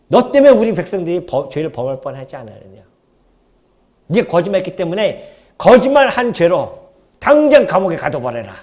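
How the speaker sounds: loud royal rebuke, fading at the end; angry king. Acted. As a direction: scold like a stern king. King rebuking Isaac again. (왕이 이삭을 책망하는 호통)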